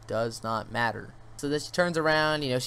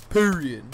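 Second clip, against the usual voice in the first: "period." punched louder and higher as a final word; emphatic teacher-style ending; 4 semitones higher, 8 dB louder than usual. Retold or imitated. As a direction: hit "period." hard, like a teacher closing the matter. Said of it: "like to add that at the end".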